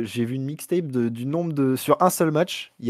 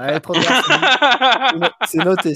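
laughing; 4 semitones higher, 5 dB louder, slower than usual. Real, not acted. Laughing while noting bets.

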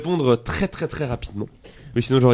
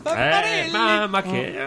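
mock Italian; 5 semitones higher, 3 dB quieter, much slower than usual. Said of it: "un mot italien".

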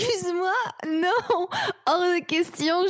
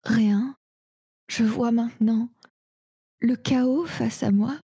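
dazed drugged voice; 8 semitones lower than usual. Acted. Drugged, seeing chaos.

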